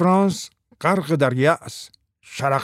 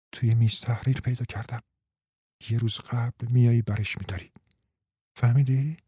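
softer, hushed instructions; lowered voice; 4 semitones lower, 5 dB quieter than usual. Acted. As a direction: keep it secret, voice kept down. (آهسته و پنهانی)

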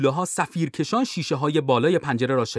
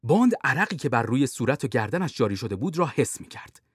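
usual speaking voice

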